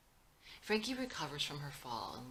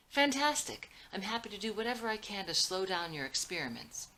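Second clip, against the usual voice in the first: sarcastic; 5 semitones higher, 6 dB louder than usual. Acted. Father mocks son's complaint.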